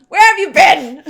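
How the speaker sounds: high, very loud mock outburst; shouted sisterly scolding, played for laughs; exasperated sister. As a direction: demand it like a fed-up sister, hammed up. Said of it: "if she were my sister, I'd be like".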